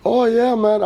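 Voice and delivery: high, bright-pitched Jamaican "Oh, yeah, man"; imitating Jamaican accent. Retelling the FaceTime guy's greeting, in a light Jamaican accent. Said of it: "a Jamaican accent".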